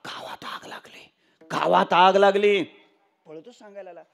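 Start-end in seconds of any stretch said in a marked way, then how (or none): 0.1-1.1 voiceless gasped whisper, as if winded; running servant. Acted. Servant runs to the old man with news of fire, breathless whisper. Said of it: "पळतच आला". 1.4-2.6 voiced alarm, called out after the gasp; running servant. Servant runs to the old man with news of fire, calling it out. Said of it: "पळतच आला".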